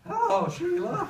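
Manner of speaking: high, loud, slow panicked squeak; a teen freezing when his date picks up, loud squeak. Retold or imitated. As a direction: blurt her name in panic. Panic when girl answers.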